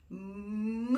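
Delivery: low, soft, long moo; drawn-out quiet cow sound. Acted. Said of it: "Which animal makes that sound?".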